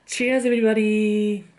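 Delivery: cheerful toast. Real, not acted. Toasting the viewers with beer.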